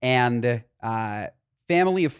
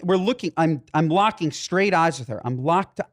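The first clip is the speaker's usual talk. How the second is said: ordinary talk, nothing marked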